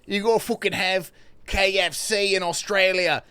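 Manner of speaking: mock Aussie voice, loud and excited; Australian accent gushing about KFC; imitating an Australian. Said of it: "they're like".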